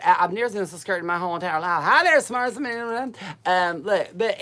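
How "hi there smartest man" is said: loud, high, drawn-out mock panic; panicked outburst. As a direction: act out a scared cry, high and loud. Acting out being terribly scared.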